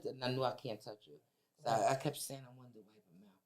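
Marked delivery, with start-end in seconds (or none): 2.5-3.4 tearful hush. Real, not acted. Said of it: "And I'm crying".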